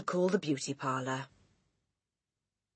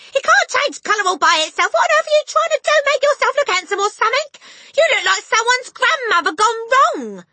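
shrill, very high loud tirade; scolding cry; 12 dB louder than usual. A narrator's reading. Mother berating husband, high scolding yell. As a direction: rail at him loudly. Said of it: "the mother cried".

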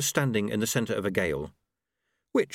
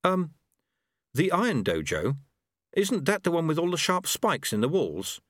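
hesitant, higher questioning voice; surprised; 7 semitones higher than usual. A narrator's reading. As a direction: query it with mild alarm. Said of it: "Lobsang looked surprised".

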